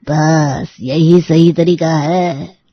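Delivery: drawn-out, deliberate endorsement; slow approval. Acted. Uncle endorses mother's plan against son, slow approval. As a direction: stretch it out with approving weight.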